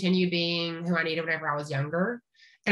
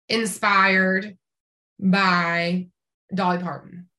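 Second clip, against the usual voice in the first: ordinary talk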